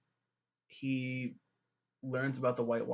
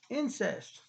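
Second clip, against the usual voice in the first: Incest. thrown up high in sarcastic horror; mock-shocked exclaim; 11 semitones higher than usual. Reacting to the twins, sarcastic high Incest. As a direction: gasp out Incest. like a scandalized gossip. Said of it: "being sarcastic".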